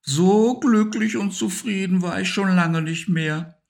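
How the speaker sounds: high, contented hamster voice; happy hamster. Acted. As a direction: sigh out your happiness. Harry content after the party. (hoher, zufriedener Hamster)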